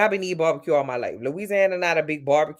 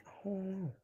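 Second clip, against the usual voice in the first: breathy whisper of I don't know; whispered aside; 4 semitones higher, 18 dB quieter than usual. Real, not acted. To herself while puzzling over the comment, a whisper. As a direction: drop to a whisper, unsure.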